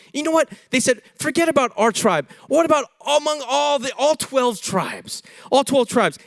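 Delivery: hammed-up parental pleading; a touch of exasperated mom and dad; indignant parents.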